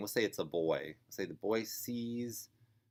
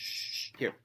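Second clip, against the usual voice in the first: quick unvoiced shushes, then giving in; hurried hushing. Mom giving in to crying boy, hushing him. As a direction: shush the child and hand it over.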